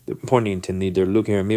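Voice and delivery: usual speaking voice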